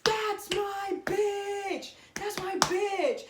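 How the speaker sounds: high, slow, drawn-out claiming shout; possessive alpha yell. Pretend. Bursting in to claim the listener. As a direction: stake your claim loudly and slowly. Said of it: "they're like".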